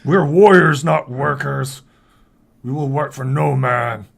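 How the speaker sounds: loud, defiant orc; proud orc warrior. Acted. Orc refuses noblewoman's offer of work, loud defiance.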